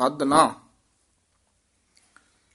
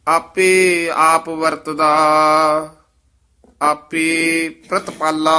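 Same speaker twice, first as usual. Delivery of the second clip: measured, sustained verse recital; scripture recitation; 8 dB louder, much slower than usual. Acted. Teacher reciting scripture for students, measured recitation. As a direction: recite sacred verse with drawn-out line endings.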